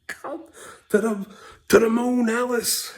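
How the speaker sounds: very high, loud, laughing squeal; mock sitcom threat. Dad riffing on the news in a loud squealing voice. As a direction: shriek the threat like a cartoon husband. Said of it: "The fuck?".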